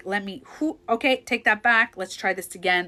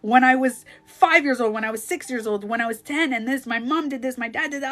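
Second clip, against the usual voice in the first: realizing; 5 semitones higher, 5 dB louder than usual. Retold or imitated. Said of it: "instead of being like".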